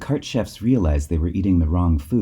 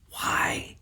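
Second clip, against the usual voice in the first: a puzzled, unvoiced whisper; 6 dB quieter than usual. A narrator's reading. Friends whisper back to Kip in the dark, puzzled. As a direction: whisper the question back, barely audible. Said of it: "they whispered back".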